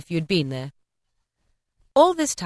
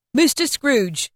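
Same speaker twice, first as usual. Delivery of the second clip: Bob's toast; 7 semitones higher, slower than usual. A narrator's reading.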